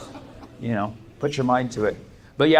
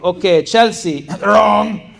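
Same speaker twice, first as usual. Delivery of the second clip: higher, louder teasing roll call of wrong answers; mock professor calling out; 7 semitones higher, 8 dB louder, much slower than usual. Professor shaming students' answers in front of class, mock glee. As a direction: announce each failure like a gleeful public shaming. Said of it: "So he was like".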